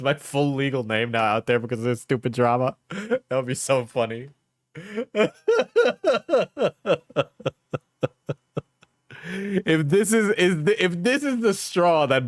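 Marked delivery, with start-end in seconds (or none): 4.8-7.2 high, breaking laugh near tears; hard laugh. Real, not acted. Streamer laughing at being doxxed, high cracking laughter. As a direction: laugh until it almost turns to crying. Laughing at possible doxxing drama.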